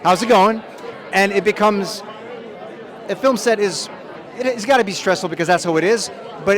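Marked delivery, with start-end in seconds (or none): 0.0-0.6 cheerful drop-in. Retold or imitated. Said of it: "be like".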